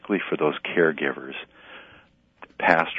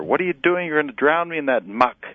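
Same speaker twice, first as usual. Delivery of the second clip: much higher, louder outraged shriek; screaming bloody murder; 10 semitones higher, 4 dB louder than usual. Acted. Vine screaming at the gardener dumping manure, outraged. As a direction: scream as if being drowned in muck. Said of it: "the vine screams bloody murder".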